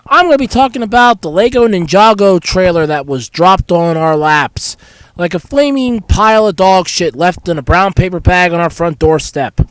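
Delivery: ordinary delivery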